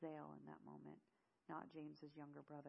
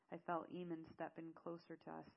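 plain reading voice

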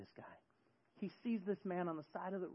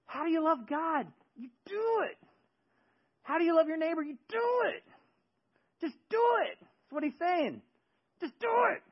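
high, loud impassioned sermon; fervent preaching; 8 dB louder than usual. Acted. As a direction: preach it with full fire. Preaching at full force.

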